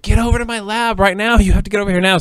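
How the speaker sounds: high, insistent phone order; imitated urgent summons. Imitating scientist friend calling her to the lab, urgent.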